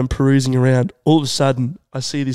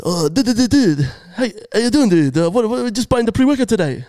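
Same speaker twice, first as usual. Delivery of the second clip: high, stuttering hyped-up dude; wired store clerk; 7 semitones higher than usual. Retold or imitated. Jacked clerk greeting a pre-workout buyer, wired stutter. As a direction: trip over words like you're buzzing. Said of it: "he was talking like he'd just taken some of the pre-workout".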